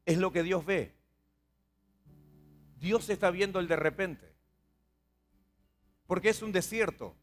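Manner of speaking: ordinary talk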